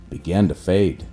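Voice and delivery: usual speaking voice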